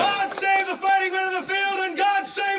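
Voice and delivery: loud, high call to cheer; rally the crowd into three cheers; rousing shout. Acted. Crying out to the crowd.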